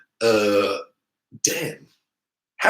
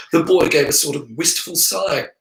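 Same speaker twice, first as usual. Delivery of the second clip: ordinary delivery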